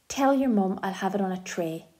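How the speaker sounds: ordinary delivery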